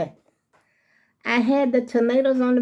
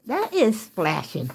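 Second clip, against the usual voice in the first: ordinary talk, nothing marked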